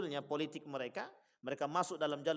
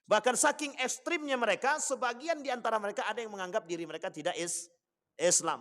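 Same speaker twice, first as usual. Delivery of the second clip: high, raised sermon voice; forceful preaching; 7 semitones higher, 4 dB louder than usual. Real, not acted. Lecturer warning congregation about sects, forceful preaching. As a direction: drive each point home, pitched high.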